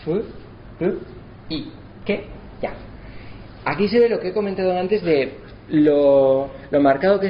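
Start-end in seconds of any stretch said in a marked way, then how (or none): 0.0-2.7 Fu, ru, i, que, ya split into separate syllables. Shown as a demonstration. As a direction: tap out each syllable apart. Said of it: "Furu y keya".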